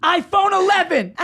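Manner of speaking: high, loud gleeful yell imitating a daughter; triumphant kid's shout. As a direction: belt it out like a kid claiming her prize. Daughter celebrating her goal, re-enacted.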